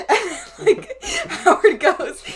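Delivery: high, loud, drawn out through laughter; laughing. Real, not acted. Cohost retelling her favorite film moment, laughing. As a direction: barely get it out for laughing. Cracking up while retelling a scene.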